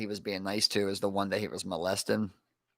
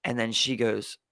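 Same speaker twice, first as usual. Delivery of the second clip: ordinary talk, nothing marked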